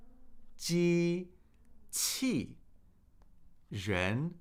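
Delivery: "机" high and level, "器" falling, "人" rising, each apart; syllable by syllable tones. Shown as a demonstration. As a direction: split "机 器 人" and give each its own tone. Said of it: "that's a first tone, fourth tone, second tone".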